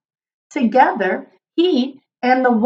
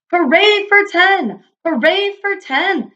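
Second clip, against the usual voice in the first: high, slow, joyful cheer; hooray it out like a happy crowd; a crowd of numbers celebrating the new name, cheering; 8 semitones higher than usual. A narrator's reading. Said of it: "they cheered".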